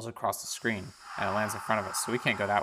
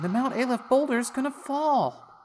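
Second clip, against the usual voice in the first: high, slow woman's voice, a bit louder; worried woman; 5 dB louder than usual. Acted. Woman warning Isaac in bed, high slow voice. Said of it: "a woman standing next to us saying".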